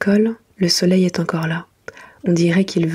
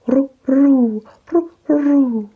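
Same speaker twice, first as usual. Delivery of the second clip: higher, louder cooing dove sound; 7 semitones higher, 4 dB louder, much slower than usual. Retold or imitated. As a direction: coo like a turtledove.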